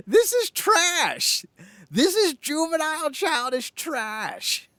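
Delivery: drawn-out sneering put-down; mock disgust. Scene: parodying the outraged critic, high slow mock disgust.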